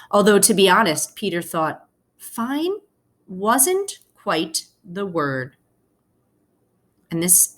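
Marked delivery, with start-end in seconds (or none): 0.1-5.6 gentle read-aloud to the class; story narration. A narrator's reading. Teacher reading a picture book to her class, storytelling voice. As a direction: tell the story slowly to your students.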